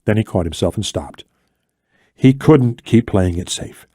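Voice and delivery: ordinary delivery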